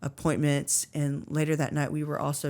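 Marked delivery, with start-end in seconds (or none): none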